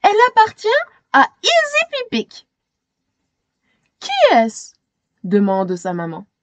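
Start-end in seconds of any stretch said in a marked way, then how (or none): 0.0-2.5 put-on high, louder girl's voice. Shayna insists to her family, high girlish voice. 4.0-4.7 lightly voiced mother, higher. Mother asks Shayna, lightly voiced.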